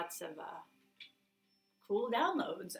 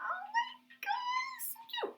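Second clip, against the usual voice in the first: cuteness squeal. Retold or imitated. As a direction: burst with delight at a baby animal. Biggest imagined cuteness reaction.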